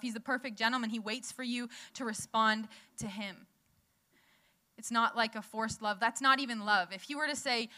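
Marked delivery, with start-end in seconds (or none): none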